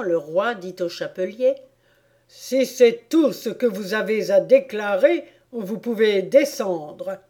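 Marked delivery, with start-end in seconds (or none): 2.3-7.2 loud, deliberate royal voice; dismissive King. Acted. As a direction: dismiss the witness from the bench.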